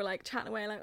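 normal talking voice

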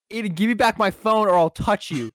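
shouted across a silent room. Retold or imitated. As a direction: yell it across the classroom. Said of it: "Ben shouted across the room".

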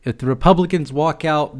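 ordinary talk

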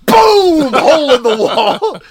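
hammed-up impact yell; mock crash yell. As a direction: shout the crash, laughing. Reenacting the wall smash.

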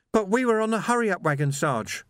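high-pitched, anguished protest; upset young constable. A narrator's reading. Young Sam to his sergeant about the wagon, anguished. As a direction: protest high, falling on the last word.

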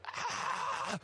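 shrill ride scream; high scream. Retold or imitated. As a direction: shriek up high. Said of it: "It's like".

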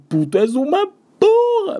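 high, drawn-out exasperated cry; hammed-up fury at a friend; mock angry outburst. Venting at his friend, mock exasperated cry.

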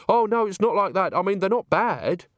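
high, emphatic correction; protest, pitched well up. Acted. Agnes setting Christine straight about witches, protesting.

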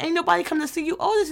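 mock movie delivery. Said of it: "the quote that".

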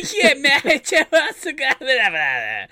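high, loud yell dissolving into laughter; mock bellhop yelling. Imitating the yelling bellhop for laughs, high loud holler. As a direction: holler a drawn-out, hammed-up rant. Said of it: "the bellhop guy who keeps yelling".

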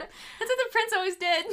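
high, loud mock amazement; hammed-up crowd recognition; amazed crowd. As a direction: exclaim it like an awestruck onlooker. Playing along as the amazed crowd.

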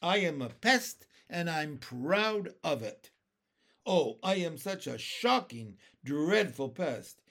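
loud, gleefully proud centipede; happy boast. A narrator's reading. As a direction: relish being a pest, drawing out the last words. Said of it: "cried the centipede happily".

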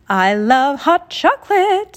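delighted. Acted. Genie delighting in cocoa.

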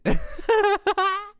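high-pitched cackling laugh; laughing at oneself. Real, not acted. Host laughing at their own joke, helpless laughter. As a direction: crack up at your own impression. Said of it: "I make myself laugh too much".

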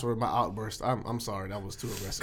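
calm it right down; sheepish apology to listeners; quiet apology. Real, not acted. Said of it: "I wanna apologize".

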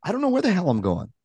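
fast, high, rattled self-talk; panicked inner voice. Retold or imitated. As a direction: spiral into panic out loud. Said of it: "internal dialogue that says".

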